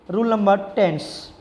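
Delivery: ordinary talk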